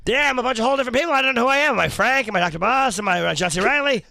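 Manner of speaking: high, loud, fast confused celebrity voice; layered impression. Comedian asked for a stacked impression, hammed up. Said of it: "Frank Caliendo doing John C. Reilly doing Doctor Buss".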